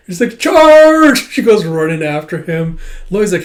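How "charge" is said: very high, loud, held charge; playful battle cry. Retold or imitated. Child running after the dog, playful battle cry. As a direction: yell it like a kid storming off.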